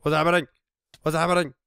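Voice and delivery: startled, asking in alarm; sudden panic under attack. Real, not acted.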